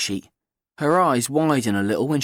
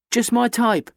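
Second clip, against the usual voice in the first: put-on higher, bright cheeky girl; 11 semitones higher, 3 dB louder than usual. A narrator's reading. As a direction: play the confident teenage girl. Kat voiced.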